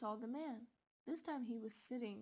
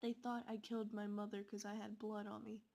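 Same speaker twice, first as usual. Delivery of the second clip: usual speaking voice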